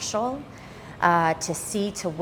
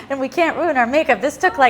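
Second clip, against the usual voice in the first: laughing; 6 semitones higher, 7 dB louder, faster than usual. Real, not acted. Laughing off tears.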